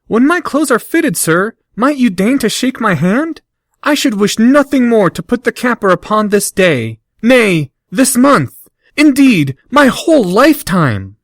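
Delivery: high, gushing fawning voice; fawning flattery. Acted. Harry gushing over Malfoy, fawning and high. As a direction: lay the flattery on thick.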